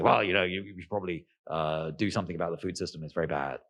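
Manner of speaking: low, droning mock expert; boring pundit voice. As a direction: drone it like a dull talking head. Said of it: "these sort of standard boring narratives".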